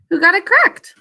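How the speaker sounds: ordinary talk, nothing marked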